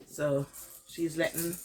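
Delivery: ordinary delivery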